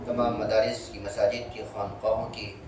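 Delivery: low, steady dua recitation; prayer. Acted. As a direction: pray quietly and humbly over the gathering. Closing dua after lesson.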